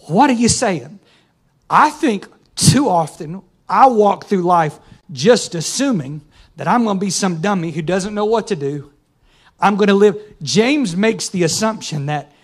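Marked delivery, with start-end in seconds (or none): none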